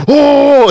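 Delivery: high loud moan into the mic; mock mic prank noise. Shown as a demonstration. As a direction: push out a high, breath-filled "oh" to annoy. Shows noise made into mic.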